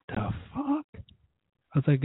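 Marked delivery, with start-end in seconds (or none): 0.1-0.9 shot way up high in disbelief; squeaky indignant outburst; outraged the fuck. Retold or imitated. Reacting to the closed shop, pitched high in disbelief.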